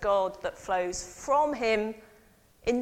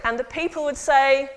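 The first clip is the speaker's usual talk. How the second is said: ordinary delivery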